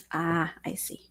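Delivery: usual speaking voice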